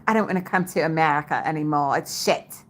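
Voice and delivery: quick imitation of a British friend; brisk mock-British delivery; British accent. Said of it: "he has a British accent".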